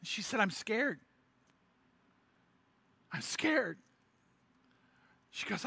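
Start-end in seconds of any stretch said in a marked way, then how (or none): 0.4-3.8 hushed, fearful confession; scared. Retold or imitated. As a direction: voice a frightened woman, softly. Frightened woman in skit.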